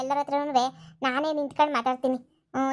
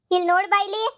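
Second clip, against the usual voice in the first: calling out; 6 semitones higher than usual. Acted. Wife calls sister-in-law over.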